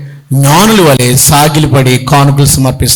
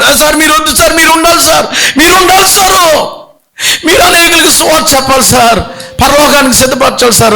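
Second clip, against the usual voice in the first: desperate pleading shout; 12 semitones higher than usual. Acted. Man begs pastor not to die.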